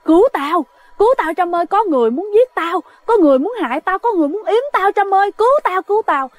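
begging a friend to save you, terrified; panicked and scared. Retold or imitated. Friend calling at midnight begging for help, high panicked plea. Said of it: "Bắt đầu cứ nói mà nói vậy đó".

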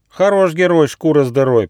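high, loud teasing rhyme; joking. A narrator's reading. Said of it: "пошутил бельчонок Рыж".

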